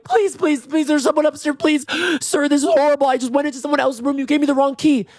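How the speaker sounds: very high, frantic mock begging; panicked pleading. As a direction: beg in a hammed-up squeal. Retold panicked plea to receptionist.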